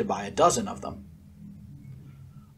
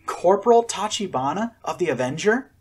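calling out; 5 semitones higher, 5 dB louder than usual. A narrator's reading. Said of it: "someone called to the Robinsons".

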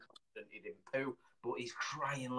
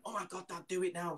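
lightly voiced eager kid, pitched higher; hushed, higher urging, imitating a child; excited son; 6 semitones higher, 4 dB quieter than usual. Son urging dad to do the prank, lightly voiced excited kid. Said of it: "Kieran went".

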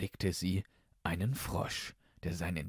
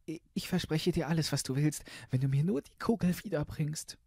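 put-on high, fast girlish voice; hasty princess; 8 semitones higher, faster than usual. Princess promises the frog everything, quick and high.

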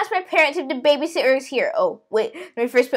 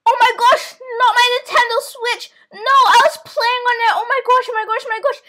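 higher, gasping protest; shocked panic; 7 semitones higher, 4 dB louder than usual. Pretend. Child asked to hand over Switch, dramatic dismay. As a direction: protest as if robbed of a treasure.

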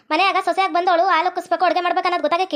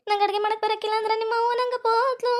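high sing-song sobbing complaint; hammed-up weepy tattling to a husband; tearful wailing; 4 semitones higher, 4 dB quieter than usual. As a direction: whimper it out high, drawing out the end. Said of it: "ಅಳ್ಬೇಡ, ಅಳ್ಬೇಡ".

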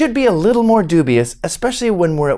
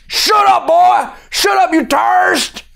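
very high, hammed-up yelling; angry cop shout; 12 semitones higher than usual. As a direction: shout the boy down as a caricature cop. Cop cuts off the pleading boy.

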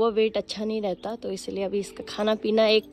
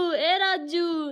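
high, drawn-out call to a pet; playful, stretched-out name call; 6 semitones higher, much slower than usual. Shown as a demonstration. Calling the kitten by its new name.

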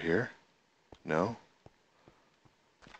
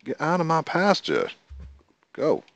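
high shoo; 11 semitones higher than usual. Real, not acted. Shooing cows off pasture.

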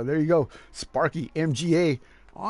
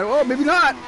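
raised, urgent race call; excited commentary; 8 semitones higher than usual. Real, not acted. Commentator goading a slowing driver, excited and high. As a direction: ride high in pitch as it unfolds.

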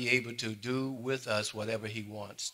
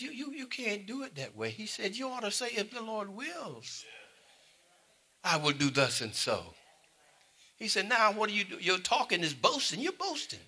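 apostle James scolding; 11 semitones higher than usual. Retold or imitated. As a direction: chide the boasters gently. Voicing James rebuking boasters.

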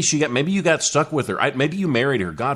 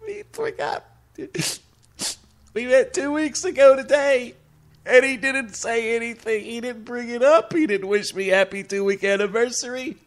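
put-on much higher, whining girly voice; whiny girlfriend voice; 11 semitones higher than usual. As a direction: whimper it like a heartbroken girlfriend. Girlfriend whining, voice redone high.

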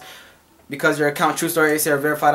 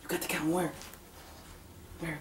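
very soft coaxing murmur; soft soothing; 18 dB quieter, faster than usual. Real, not acted. Mom coaxing her fussy baby, very softly.

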